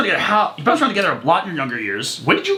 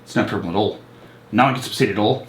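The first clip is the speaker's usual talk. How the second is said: put-on a lightly done Australian accent, lower and quick at first; 7 semitones lower, much faster than usual. Showing the guys he can do Australian, light Australian accent. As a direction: ham up an Australian twang. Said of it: "Very good".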